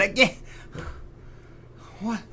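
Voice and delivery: normal talking voice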